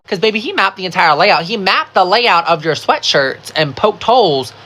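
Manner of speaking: ordinary delivery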